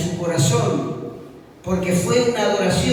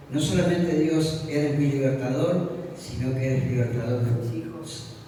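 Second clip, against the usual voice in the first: low, soft, measured prayer voice; 5 semitones lower, 3 dB quieter than usual. Retold or imitated. Preacher addressing God as his liberator, hushed and prayerful. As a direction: pray it in short, lowered phrases.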